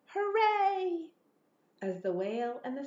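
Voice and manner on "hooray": high, loud happy cheer. Acted. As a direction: villagers cheering the whale away. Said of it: "villagers shouting".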